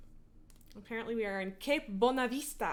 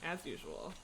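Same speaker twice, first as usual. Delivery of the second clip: "as usual" trailed off softer and lower with a rising lilt; wry as usual; 8 semitones lower, 9 dB quieter than usual. Real, not acted. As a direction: toss off "as usual" with a dry, knowing rise. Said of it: "as, as in, that is my hope".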